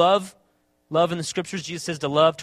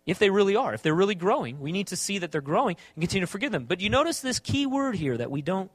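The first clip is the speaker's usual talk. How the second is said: normal talking voice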